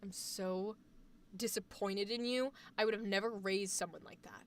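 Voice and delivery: low, hushed disappointed mother; older mom scary. Acted. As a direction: quietly shame your child, voice dropped low. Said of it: "the older mom scary is like a".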